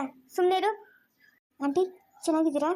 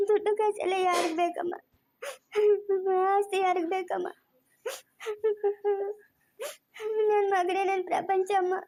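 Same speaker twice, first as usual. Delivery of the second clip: mother lamenting through sobs; slow tearful wail, broken by sobbing; crying; 4 semitones higher than usual. Real, not acted. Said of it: "ಯಾಕೆ ಇವಾಗ ನೀನ್ ಅಳ್ತಾ ಇರೋದು?".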